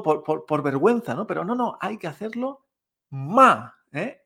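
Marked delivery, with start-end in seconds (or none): 3.1-3.8 loud "ma" plunging sharply; mock-angry fourth tone. Shown as a demonstration. Teacher urging shy students to sound angry, loud falling "ma". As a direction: throw "ma" down as if cross.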